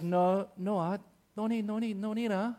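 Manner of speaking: high, dismissive Singaporean auntie; Singaporean auntie accent. Acted. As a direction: wave it away in a put-on Singaporean accent. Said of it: "I can't do the accent".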